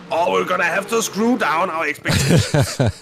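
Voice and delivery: put-on high, loud Danish-accented English; thick Danish accent. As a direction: quote him in a heavy Danish accent. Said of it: "he said the beautiful phrase".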